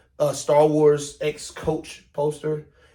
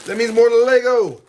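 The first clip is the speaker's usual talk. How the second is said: excited; 8 semitones higher, 9 dB louder than usual. Real, not acted. Answering own question excitedly.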